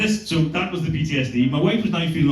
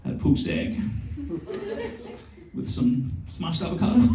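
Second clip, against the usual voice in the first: sheepish whisper; 5 semitones higher, 7 dB quieter than usual. Retold or imitated. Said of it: "I was like".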